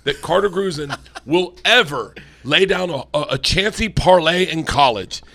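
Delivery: loud, high-pitched comic tirade; emphatic rant. Real, not acted. Comedian vowing the kid will never bet badly, emphatic rant. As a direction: build to a loud, insistent proclamation. Mock-triumphant rant about the lesson taught.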